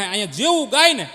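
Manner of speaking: normal talking voice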